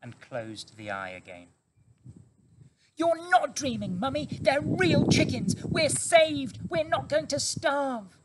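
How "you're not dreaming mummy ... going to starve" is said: put-on high, loud young fox, insistent and thrilled; eager small fox. A narrator's reading. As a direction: cub convincing his weak mother.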